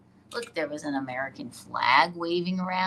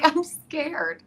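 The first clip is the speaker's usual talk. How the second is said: imitating Amy; 10 semitones higher, 4 dB louder than usual. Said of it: "She's like".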